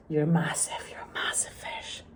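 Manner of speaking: soft breathy whisper; hushed aside. Real, not acted. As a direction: let it slip out as a secret.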